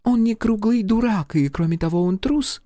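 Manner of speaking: high-pitched French lady voice; femme fatale. Acted. Charlotte's voice again.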